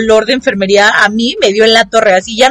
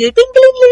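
high, tinkly imitation of glass vials clinking; sing-song glassy tinkle, high; clinking bottles; slower than usual. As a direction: ring out the clink of vials on a cart.

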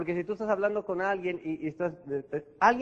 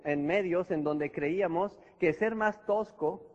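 ordinary delivery

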